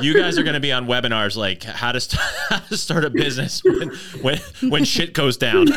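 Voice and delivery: loud laughing joke. Real, not acted. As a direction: crack up while saying it. Joking about pandemic webinars.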